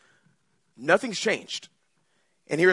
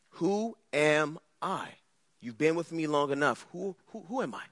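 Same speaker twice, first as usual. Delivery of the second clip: tender Jesus; 3 semitones lower, 6 dB quieter than usual. Acted. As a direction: lean in gently, pressing the question. Jesus presses disciples intimately.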